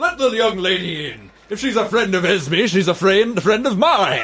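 high, hearty booming call; booming Santa-like voice. Acted. Santa-like voice welcoming the young lady, loud and jovial. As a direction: boom it jovially from behind the gate. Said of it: "a booming voice that sounds like it might be Santa".

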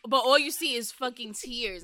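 normal talking voice

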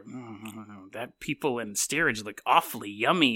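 low, hushed, greedy rat; a lightly voiced hungry rat eyeing its prey; mock rat craving. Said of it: "Rats would start to check out each other".

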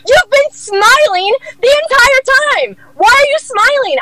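shrieked mock outrage, very high and slow; imitated screaming. Boss catching her smiling the entire time, imitated screaming. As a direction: screech it like an enraged boss, stretching the words. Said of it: "Kristen was screaming her fucking head off".

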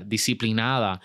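normal talking voice

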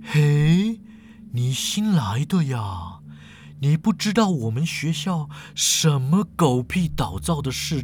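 slow, drawn-out character voice; teasing professor with stretched endings; unruffled old professor. Acted. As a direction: explain ghost rules at a leisurely pace.

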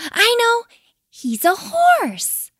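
high, loud, eager outburst; excited little kiwi. A narrator's reading. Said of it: "jumping up and down".

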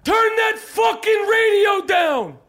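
high, slow, stretched-out yell; hammed-up screamed demand; furious shouted order. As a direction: bellow it over blaring music. Acted rant at bodega worker.

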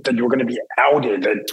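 ordinary talk

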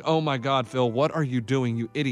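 low groaning disbelief at a golfer's blunder; exasperated groan at Phil. Retold or imitated. Host reliving watching Phil hit into water, low exasperated mutter. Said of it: "and I'm like".

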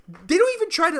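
ordinary delivery

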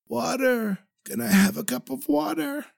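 weak parched plea. Acted. Waking from coma, mouth dry.

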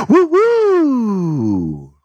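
high cackle bursting into a long whoop; sudden exuberant laugh and soaring holler. Shown as a demonstration. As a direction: let loose a high, wild "woo woo". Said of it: "feel higher levels of expression".

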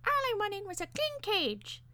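very high squeaky bird voice; plaintive parakeet. A narrator's reading. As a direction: lament it as a wronged little bird. Parakeet defends itself plaintively.

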